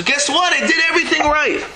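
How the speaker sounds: high, fast, pleased-with-himself protest; hammed-up boast of a man sure he's blameless; smug self-justifier. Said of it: "the guy turns around and says".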